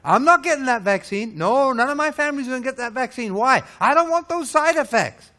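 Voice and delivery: hammed-up defiant no; mock stubborn refusal. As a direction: play the believer who won't take it. Mocking anti-vaccine believers.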